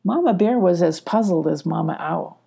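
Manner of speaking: plain reading voice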